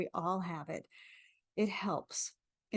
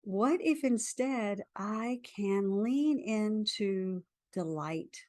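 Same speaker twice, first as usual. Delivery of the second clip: guided affirmation. Acted. Leads a tapping affirmation.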